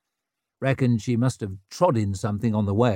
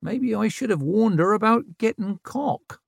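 sly aside; 11 semitones higher than usual. Acted.

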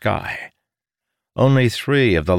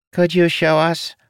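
much higher creature voice; high-pitched voice; 11 semitones higher, 3 dB louder than usual. Acted. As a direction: lift it up high, a small creature asking. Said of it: "voice higher pitched than Ozai Kazanidas".

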